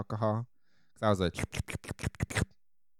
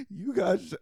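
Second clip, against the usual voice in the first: gasping, higher, cut-off panic; shocked gasp; 11 semitones higher than usual. Retold or imitated. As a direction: gasp and break off mid-word in fright. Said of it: "And I was like".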